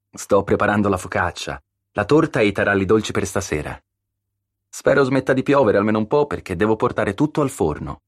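lightly voiced chatty baker; busy home baker. A narrator's reading. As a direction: tell a friend about all your baking. Concetta chats about her baking.

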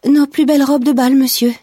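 put-on high-pitched, bashful little-girl voice; embarrassed little girl. A narrator's reading. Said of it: "avec un air authentique de petite fille gênée".

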